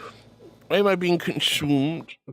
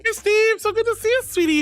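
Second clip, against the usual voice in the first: much higher, sing-song hello; high sweet greeting; 13 semitones higher than usual. Real, not acted. Greeting Steve in chat, high and sweet. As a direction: squeal a happy hello.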